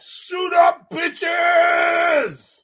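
very high-pitched rallying shout; catchphrase shout. Pretend. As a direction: yell it as a hyped-up show catchphrase.

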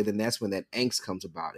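normal talking voice

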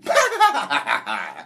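loud cackling laugh again; mock witch cackle; witchy sleep laugh. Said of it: "I hear it again".

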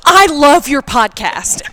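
slow, loud, gushing fan line; retold excited fan. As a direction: gush it out loud, stretching each word. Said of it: "like, really emphatically and excitedly".

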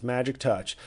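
ordinary talk, nothing marked

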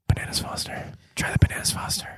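unvoiced-soft, secretive whisper in someone's ear; ASMR whisper. CIA operative leaning over to a diner, whispering. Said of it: "I don't know why he's whispering in your ear, A S M R style".

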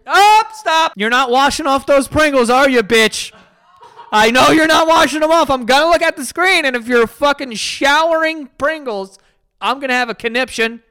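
high, loud scolding at the screen; loud mock-scolding at a woman washing Pringles; exasperated yelling. Real, not acted. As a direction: yell at her to stop.

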